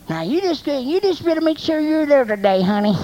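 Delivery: high-pitched mock parental nagging. Acts out pressuring his daughter.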